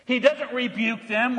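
normal talking voice

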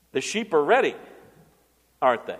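ordinary talk

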